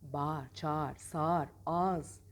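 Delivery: Bağır as "baar", çağır as "çaar"; spoken form. Shown as a demonstration. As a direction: list sağır and ağız with one long a. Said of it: "Tabii ki ikincisi".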